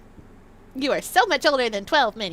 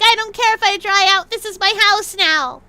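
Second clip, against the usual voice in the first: defiant shout; 6 semitones higher, 8 dB louder than usual. Pretend. Octopus defying staff.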